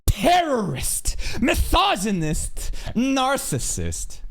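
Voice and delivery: high, slow, wailing mock sob; hammed-up whiny victim list; mock crybaby whine. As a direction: whimper the accusations like a crybaby. Mocking a whiny accuser.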